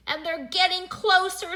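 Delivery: high, loud, urgent warning; rising alarm. A narrator's reading. Arthur warning friends of approaching footsteps, rising alarm. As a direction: warn them as the danger nears.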